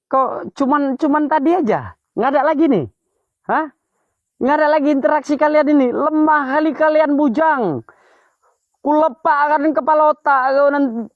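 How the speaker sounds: high, loud provoking yell; taunting shout. Real, not acted. Ghost hunter dares the spirits to show up, high taunting shout. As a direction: goad the spirits like picking a fight.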